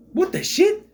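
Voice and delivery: high, loud mock disbelief; exaggerated outburst. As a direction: overact a shocked question, pitch shooting up. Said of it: "He comes out, he's like".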